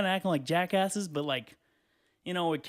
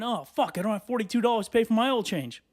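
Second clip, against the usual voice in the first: mildly annoyed at himself, a little higher; pissed off; 6 semitones higher than usual. Acted. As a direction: act out kicking yourself, half in earnest. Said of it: "I'm pissed off at myself going".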